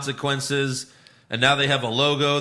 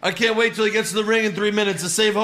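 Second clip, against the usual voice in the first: mock commentator excitement; 8 semitones higher, 6 dB louder, faster than usual.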